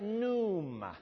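Pneuma said as NOO-mah, drawn out; silent P. Shown as a demonstration. Preacher teaches a Greek word, Pneuma with a silent P. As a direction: say Pneuma with no P sound. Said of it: "Silent P".

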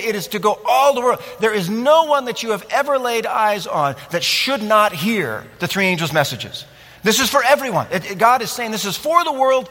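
ordinary talk

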